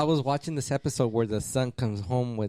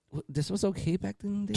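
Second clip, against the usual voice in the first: higher, stunned question; mock disbelief; 7 semitones higher than usual. Adult rewatching cartoon, mock stunned. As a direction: ask in disbelief. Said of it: "I'm like".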